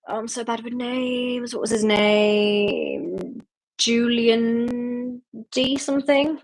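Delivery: drawn-out words while groping for a name; searching memory. Real, not acted. Host trying to remember a character's name, slow and searching. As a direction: stretch each word slowly as you think.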